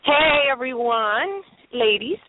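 ordinary talk

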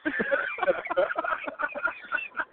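shrill wheezing laugh; high squealing laugh. Real, not acted. High laugh after robot voice.

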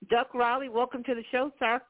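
usual speaking voice

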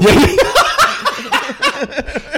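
high, loud laugh bursting out; big burst of laughter. Real, not acted. Laughing at his own date story with the co-host, loud high laugh. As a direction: crack up at your own story.

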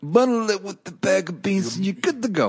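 high-pitched put-on mobster; mafia voice. Host redoes the bundle line on request, mafia voice. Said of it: "do it with a little more of a mafia voice".

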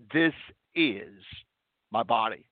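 "is" set apart by pauses; stress on is. Shown as a demonstration. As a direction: pause before and after "is". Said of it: "that word "is" needs to be truly emphasized".

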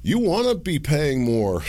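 high, insistent mock shout; mock swamp leadership. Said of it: "and why they're like".

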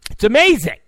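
loud, high yelp; sudden shouted catchphrase; exclaiming. Acted. Shouted repeat of hushed catchphrase.

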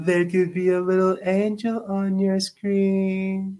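high, slow sing-song to an infant. Real, not acted. Repeats the line to the baby.

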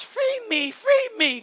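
put-on high whiny little girl plea; mock a little girl begging, high and whiny. Preacher mocking men as little girls, high whiny plea. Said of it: "Running around like little girls".

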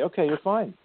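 a hint of a cheerfully unbothered guide; mock breezy reply. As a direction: toss it back like it's no big deal. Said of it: "they say".

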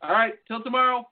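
ordinary talk, nothing marked

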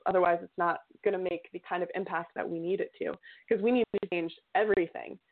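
ordinary delivery

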